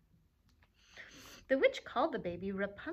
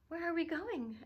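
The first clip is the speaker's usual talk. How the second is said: put-on higher, innocent little girl; 7 semitones higher than usual. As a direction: ask it wide-eyed and innocent. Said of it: "asked Rapunzel, innocently".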